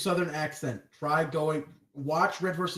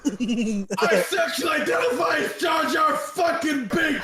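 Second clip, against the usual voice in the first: joke shout; 7 semitones higher, 6 dB louder than usual. Acted. Joke outburst on podcast.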